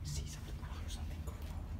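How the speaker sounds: whispering. Real, not acted. Scared whispering in tunnel.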